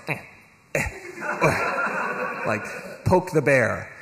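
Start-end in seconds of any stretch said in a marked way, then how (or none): 0.1-2.5 mocking snicker. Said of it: "a French man just going".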